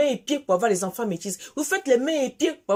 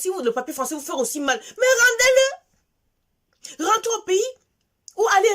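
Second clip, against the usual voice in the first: crying out in anger; 7 semitones higher, 4 dB louder than usual. Real, not acted. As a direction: voice breaks into a high loud cry. Colère montante contre les compatriotes. (cri de colère, la voix qui se brise, aigu et fort)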